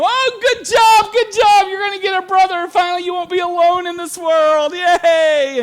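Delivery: high, loud squealing cheer; excited sisters cheering. Retold or imitated. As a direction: cheer like thrilled little sisters. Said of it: "all the sisters were happy".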